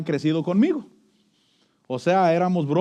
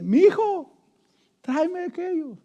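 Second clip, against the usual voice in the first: much higher, sweet mock wife; wife's voice; 10 semitones higher than usual. Husband imitating his wife calling him my son, high and sweet. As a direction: coo it like a wife asking a favour. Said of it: "la mujer le dice".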